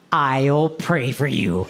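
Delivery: slow, low, pious promise; pious church lady. Retold or imitated. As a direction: lay on the sanctimony, slowly. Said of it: "has ever said to me".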